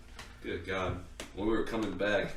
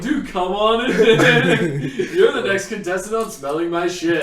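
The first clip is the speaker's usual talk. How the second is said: game show host; 8 semitones higher, 7 dB louder than usual. Pretend. Mock game show host welcome.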